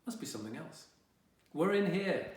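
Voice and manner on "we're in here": called out. Acted. Mum calling from another room.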